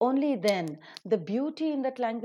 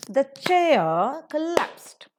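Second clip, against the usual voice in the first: slow, raised, punched beats on CHAIR and col-LAPSED; rhythmic stress; 5 semitones higher, 8 dB louder, much slower than usual. Shown as a demonstration. Teacher shows students the rhythm of the sentence, stressed beats. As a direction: stress CHAIR and the second syllable of COLLAPSED, loud and drawn out. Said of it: "is the pronunciation".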